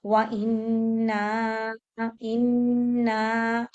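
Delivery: very slow "व इन्ना" with the madd held four counts. Shown as a demonstration. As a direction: stretch "इन्ना" long. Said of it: "four count, मद आ गया".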